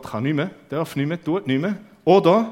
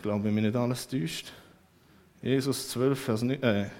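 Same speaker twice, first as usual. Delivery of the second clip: ordinary talk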